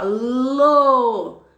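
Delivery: "Lo" said slowly and carefully, mouth shaping each sound; over-articulate "Lo"; syllable demo. Shown as a demonstration. Teacher repeating the syllable for pupils, carefully. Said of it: "Foram duas".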